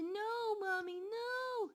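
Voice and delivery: high, loud panicked screams; terrified scream for mommy. Retold or imitated. Girl grabbed by the ghost, screaming for her mom in terror. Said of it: "as I started to scream for my mommy".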